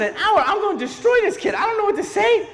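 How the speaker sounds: very high-pitched frantic protest; mock panic. Uncle protests to sister about babysitting, mock panicked. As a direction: squeal it in acted alarm.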